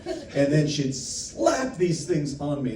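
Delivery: ordinary talk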